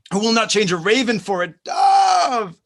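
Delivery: full-voiced shout; shouted vow. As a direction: proclaim it boldly. Passionate declaration.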